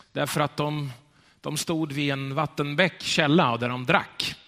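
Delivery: ordinary talk, nothing marked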